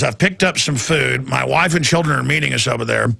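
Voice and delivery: usual speaking voice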